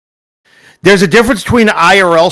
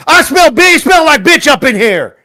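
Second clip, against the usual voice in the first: taunting sing-song; 9 semitones higher than usual. Pretend. Taunting chant about smelling.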